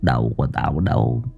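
a ghost muttering, climbing into a shrill wail; ghost murmuring. A narrator's reading. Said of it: "miệng cứ thều thào lẩm bẩm". (giọng ma lẩm bẩm rồi rít cao dần)

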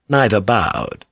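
ordinary delivery, nothing marked

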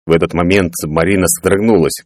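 usual speaking voice

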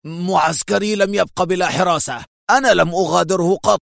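wounded pride snapping back; angry. A narrator's reading. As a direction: bristle with anger at the insult. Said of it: "في غضب".

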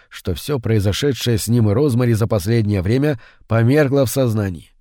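ordinary delivery, nothing marked

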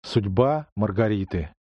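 ordinary delivery, nothing marked

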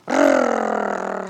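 very low, loud dog growl. Acted. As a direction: growl like a big shaggy dog. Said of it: "зарычал пёс".

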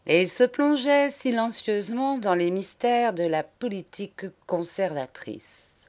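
plain reading voice

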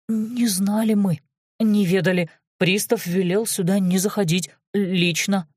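ordinary delivery, nothing marked

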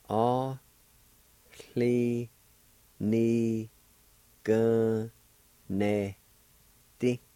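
A-hli-ni-gun-ne-ti said syllable by syllable, very slow; Cherokee word, slow syllables. Shown as a demonstration. Teacher sounding out A-hli-ni-gun-ne-ti slowly for learners. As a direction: spell out A-hli-ni-gun-ne-ti one syllable at a time, drawn out. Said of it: "It's pronounced like this".

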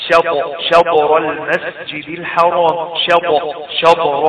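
slow شطر with the still ط bounced; qalqalah on ط. Shown as a demonstration. Teacher demonstrating mid-word ط in شطر, bounced qalqalah. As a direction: stop on the ط of شطر and let it rebound. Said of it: "الطاء ساكنة في وسط الكلمة وستقلقل".

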